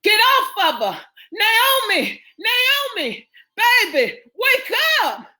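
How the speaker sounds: very high, desperate screams; screaming. A narrator's reading. Son screaming for unconscious Naomi to wake, frantic. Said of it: "her son screaming Naomi's name".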